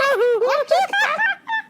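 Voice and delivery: high-pitched helpless laugh; squealing laughter; laughing fit. Real, not acted. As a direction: crack up at your own story. Laughing at own story.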